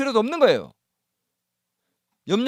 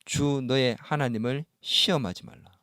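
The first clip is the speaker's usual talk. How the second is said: solemn scripture quote; 10 semitones lower, 10 dB quieter, slower than usual. Acted. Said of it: "예수님이 마귀에게 뭐라고 얘기했습니까".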